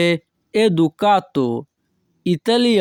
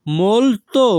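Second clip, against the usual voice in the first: molto drawn out and higher, "mol-to"; careful repetition; 5 semitones higher, 3 dB louder than usual. Shown as a demonstration. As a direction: stretch both syllables of molto, pitch raised. Teacher repeats word carefully for learners.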